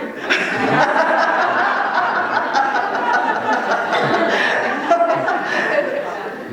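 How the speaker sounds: real high wheezing laugh; laughing helplessly; high breathy laugh. Real, not acted. Laughter at own story.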